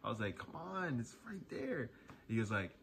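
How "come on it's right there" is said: high, pleading exasperation; whiny come on, softer; exasperated whine. Retold or imitated. Exasperated reply to yelling dad.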